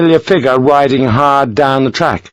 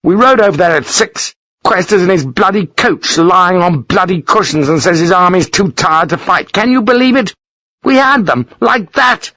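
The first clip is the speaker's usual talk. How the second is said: furious rant; 8 semitones higher than usual. Acted. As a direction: pour out the frustration. Lawford ranting.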